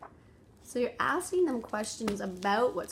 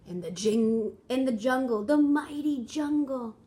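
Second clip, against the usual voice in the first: louder, rising, slowing on the mighty jungle; exciting storybook voice; 3 semitones higher, 8 dB louder, slower than usual. Shown as a demonstration. Parent reading the jungle opening to a child, excited. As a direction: build the jungle up with wonder. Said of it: "exciting voice when you're reading".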